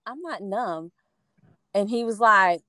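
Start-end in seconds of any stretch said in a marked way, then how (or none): 0.0-0.9 calm report. Retold or imitated. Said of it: "and I was like".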